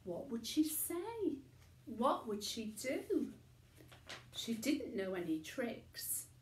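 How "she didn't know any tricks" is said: ordinary delivery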